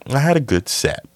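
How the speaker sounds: pitched well up, dropping at the end; high-pitched claim. Acted. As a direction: say it high, falling away. Contrasts two takes of same claim.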